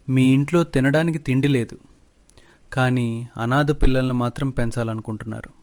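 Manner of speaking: usual speaking voice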